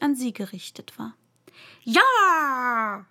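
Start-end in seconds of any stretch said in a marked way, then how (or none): 1.8-3.1 loud, long-held shout; shouted yes. A narrator's reading. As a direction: yell it back, stretching the vowel. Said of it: "schrie Alice".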